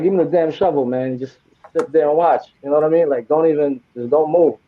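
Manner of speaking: ordinary talk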